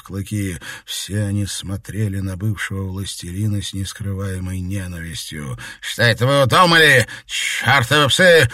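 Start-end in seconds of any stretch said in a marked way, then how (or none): 5.8-8.5 high, loud, furious snarl; angry bark. A narrator's reading. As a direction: bark it at the dogs in rage. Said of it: "злобно рявкнул старик".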